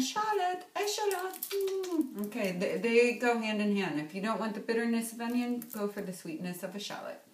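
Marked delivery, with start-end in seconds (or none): 0.0-2.3 Charlotte, echalot said much higher, sing-song; playful high pun. Acted. Introducing the shallot as onion's friend, high and playful. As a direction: lift the voice high and play the pun.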